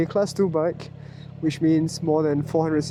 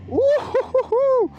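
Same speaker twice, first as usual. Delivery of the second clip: joyful whoop. Real, not acted. Whooping while riding.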